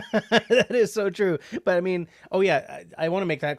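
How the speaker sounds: high, loud laugh into the words; real burst of laughter. Real, not acted. Reacting to chat comment.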